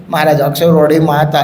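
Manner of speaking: ordinary talk, nothing marked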